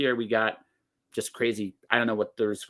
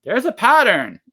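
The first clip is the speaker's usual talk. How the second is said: loud emphatic point; 10 semitones higher, 11 dB louder than usual. Real, not acted.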